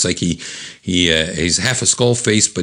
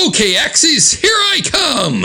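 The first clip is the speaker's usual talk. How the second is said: comic-book hero's rallying yell; battle cry. Acted. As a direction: holler it as you charge into a fight. Acts own comic battle cry.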